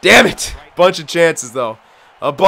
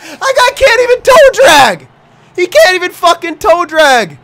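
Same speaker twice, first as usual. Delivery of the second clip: high, louder, slow disbelieving rant; incredulous laughing; 13 semitones higher, 7 dB louder than usual. Real, not acted. Gamer mocking a shootout player, incredulous high laughing.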